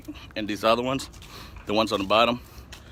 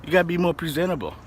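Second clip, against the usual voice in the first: mom's voice pitched up, scolding; imitating his mom; 8 semitones higher than usual. Son quoting his mom about his face, higher nagging voice. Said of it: "she was like".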